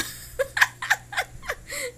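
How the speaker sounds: high peal of laughter; amused laughter. Real, not acted. Owner cracked up by the dog answering back, laughing hard. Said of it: "Naloka ako sa'yo".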